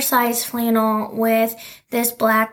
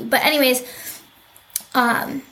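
usual speaking voice

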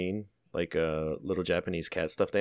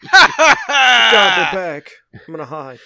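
loud, hammed-up frightened cry; mock scared; 12 semitones higher, 14 dB louder, slower than usual. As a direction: yelp in fake panic. Mock fright, villains return.

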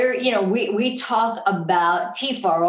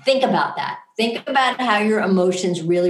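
ordinary talk, nothing marked

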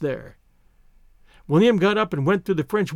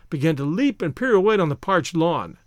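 ordinary delivery, nothing marked

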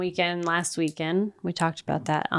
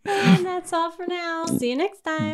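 laughing sign-off; 10 semitones higher than usual. Real, not acted. Outro through laughter.

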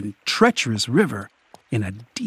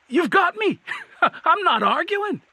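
high, breathless giving-in; surrendering troll. A narrator's reading. Troll surrendering to Cohen, high and flustered.

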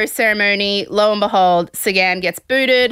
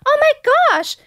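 much higher, breathless mock delight. Contestant on a date, thrilled by cheese, high. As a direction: squeal over the snack like a child. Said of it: "she turns and she goes".

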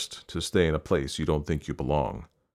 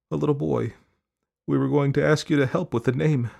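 light, higher, wistful mother's voice; mother trailing off; 8 semitones higher than usual. A narrator's reading. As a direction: let the thought trail away gently. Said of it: "She trailed off".

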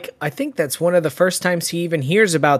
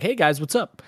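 lightly breezy, softer and lower; casual mock greeting; 4 semitones lower, 6 dB quieter than usual. Imitating cop who walked in on gunfire, casually nonchalant. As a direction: toss it off without any urgency. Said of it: "very nonchalantly".

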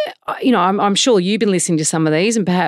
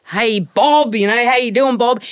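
higher, hearty mock locker-room greeting; footy locker-room bloke; 5 semitones higher, slower than usual. Podcast host mimics trainers greeting each other, mock locker-room bloke. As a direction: imitate a matey footy guy. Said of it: "they're in a footy locker room".

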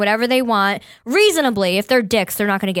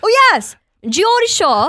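put-on much higher and louder Geordie accent on "Geordie Shore"; 10 semitones higher, 6 dB louder, slower than usual. Host hamming her Geordie impression: loud Geordie accent. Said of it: "let me talk like the Geordie Shore".

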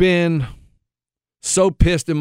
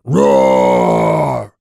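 much lower long mock roar; imitating a roar; 4 dB louder than usual. Telling his cohost how the tall kids roared at midfield, a mock roar. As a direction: growl it out like the kids on the field. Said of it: "start roaring".

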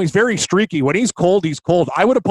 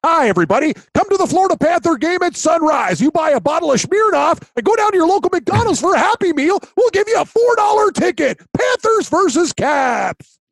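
fighting game announcer; 12 semitones higher than usual. Pretend. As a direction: hype it like a cheesy ad. Mock ad pitch.

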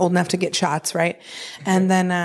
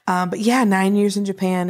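ordinary talk

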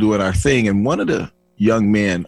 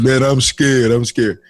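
mock scared; 5 semitones higher, 4 dB louder than usual. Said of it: "they was like".

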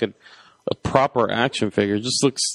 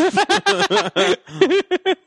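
high laugh. Real, not acted. Big laugh after quote.

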